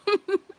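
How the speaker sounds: a sudden loud high cackle; loud burst of laughter. Real, not acted. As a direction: let out one big laugh. Laughing at polygamy joke.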